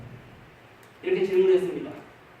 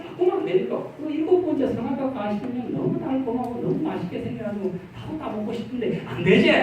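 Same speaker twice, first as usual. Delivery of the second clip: coaxing plea; 6 semitones higher, 3 dB quieter than usual. Retold or imitated. Pastor voicing himself pleading with deaconess.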